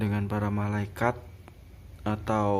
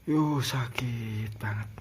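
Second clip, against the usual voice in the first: pained; slower than usual. Retold or imitated. Recalling pain of the whipping.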